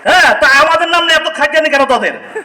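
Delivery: normal talking voice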